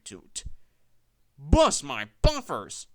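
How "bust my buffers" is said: loud, slow exclamation in a different voice; exclaiming. A narrator's reading. Rusty seeing the wreck, loud slow exclamation. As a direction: bellow it out in dismay. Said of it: "exclaimed Rusty".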